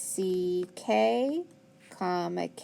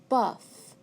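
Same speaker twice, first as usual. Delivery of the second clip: slow, drawn-out buff, holding the final f; stretched buff. Shown as a demonstration. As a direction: stretch buff so the double F is heard. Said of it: "after a short vowel".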